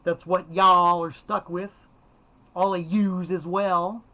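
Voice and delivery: loud, slightly hammed-up Southern-Northern patois; Southern-Northern hybrid accent. Shown as a demonstration. Telling viewers what they're stuck with, in a Southern-Northern hybrid accent. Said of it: "my really weird southern northern hybrid patois".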